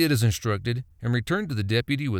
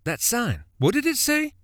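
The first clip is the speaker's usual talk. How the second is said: sudden alarmed question, much higher; 12 semitones higher than usual. A narrator's reading. Billy asks Buddy about the road sign, alarmed and high. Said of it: "he questioned".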